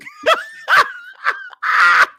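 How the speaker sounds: squealing laugh way up high; real high-pitched cackle of amusement; high burst of laughter. Real, not acted. Teasing co-host about being regular.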